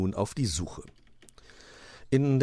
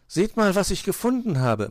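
high, loud little bird voice; little bird calling; 12 semitones higher, 5 dB louder than usual. A narrator's reading. Zwitschi shows friends a find, calling out high. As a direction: chirp for everyone's attention. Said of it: "machte Zwitschi seine Freunde".

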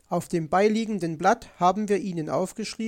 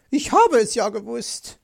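high, loud crow of triumph; gloating, louder and higher; triumphant; 4 semitones higher, 7 dB louder than usual. A narrator's reading. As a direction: crow that you knew it. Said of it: "triumphierte Frau Elster".

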